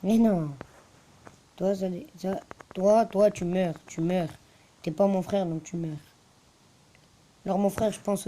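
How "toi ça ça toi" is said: ordinary talk, nothing marked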